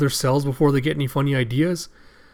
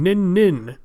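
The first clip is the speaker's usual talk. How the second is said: higher ninja catchphrase voice; Raizo; 5 semitones higher, 3 dB louder than usual. Acted. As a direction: chant it like a cartoon ninja flying in. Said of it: "Raizo flying in going".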